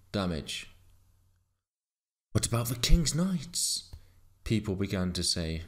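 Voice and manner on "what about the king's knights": raised, higher-pitched query; questioning villager. A narrator's reading. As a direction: wonder aloud as a concerned villager. Villagers start questioning the king's knights.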